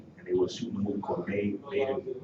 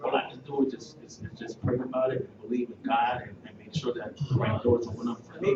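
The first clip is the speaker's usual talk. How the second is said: usual speaking voice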